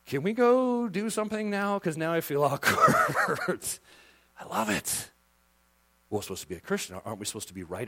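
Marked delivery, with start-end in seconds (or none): 0.1-3.6 awkward listener. Pretend. Imagined uncomfortable listener.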